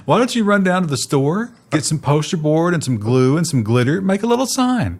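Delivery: put-on high, patronising voice as if to a child; condescending mock. Mock executive patronising protesting workers, high and sweet. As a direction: talk down to them sweetly.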